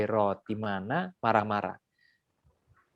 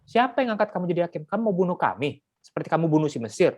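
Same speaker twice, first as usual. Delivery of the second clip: fast, cheeky comeback, higher; snide retort; 5 semitones higher than usual. Acted. Hebrew mocking Moses who tried to stop the fight, snide and fast. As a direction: throw it back insolently and fast. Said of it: "nyolot gitu loh".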